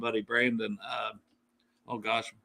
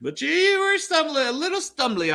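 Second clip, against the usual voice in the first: teasing; 4 dB louder than usual. Real, not acted. Teasing co-host about drinking.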